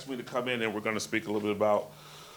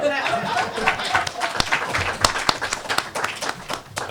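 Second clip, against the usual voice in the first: loud, high burst of laughter; high airy laugh; 7 dB louder than usual. Real, not acted. Lieutenant laughs at intro.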